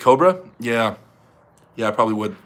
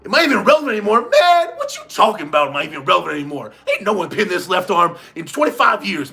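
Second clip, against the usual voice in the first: cocky, indignant trash-talk in a raised pitch; Travis Major; 5 semitones higher than usual. Acted. Travis boasting back at a doubter, cocky indignant rant. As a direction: rant like an offended champion, pitched up. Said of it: "that was my Travis Major impersonation".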